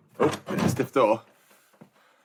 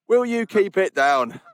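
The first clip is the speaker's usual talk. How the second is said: high, drawn-out call to pipe down; mock scolding; 7 semitones higher, 4 dB louder, much slower than usual. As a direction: playfully tell off noisy geese, slow and high. Mock scolding of noisy geese.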